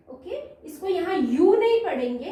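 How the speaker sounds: ordinary talk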